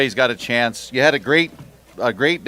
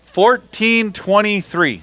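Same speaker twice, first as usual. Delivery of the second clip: "Fourteen twenty-three" slow, higher and drawn out; announcer call; 7 semitones higher, 3 dB louder, much slower than usual. Acted. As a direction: sing out the weight to the crowd. Announcing the weight to crowd.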